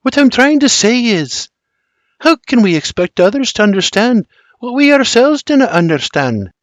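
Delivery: high, searching Scottish question; Scottish Mary, pleading. A narrator's reading. Mary looks up at William, pleading in high Scots. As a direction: ask it earnestly in a high Scottish voice.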